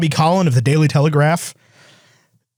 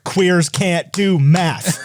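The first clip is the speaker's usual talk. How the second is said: slow words broken by laughter; laughing while quoting; 2 semitones higher, much slower than usual. Retold or imitated. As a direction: laugh through the quote. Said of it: "every single comment was like".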